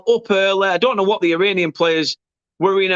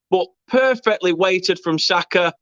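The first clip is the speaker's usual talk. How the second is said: ordinary delivery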